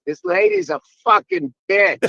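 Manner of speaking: put-on loud, high kid's outburst; seven-year-old daughter. Dad quoting his daughter after an argument, high emphatic outburst. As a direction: imitate a small girl declaring it loudly. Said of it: "and Piper goes".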